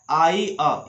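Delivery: clear, deliberate आई अ; teacher repeating आई अ; vowel demo. Shown as a demonstration. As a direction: model the sound आई अ for students. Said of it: "इस तरह का उच्चारण करना है".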